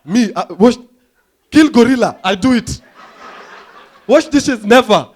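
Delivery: put-on loud, broken-English African accent; hammed-up African accent, shouted; mock African accent. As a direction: bark it out in a thick African accent. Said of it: "like, an African accent".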